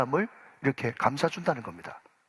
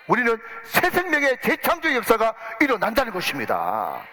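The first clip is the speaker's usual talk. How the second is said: preaching at full force; 6 semitones higher than usual. Acted. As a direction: build it up into the sermon's climax. Climax of sermon.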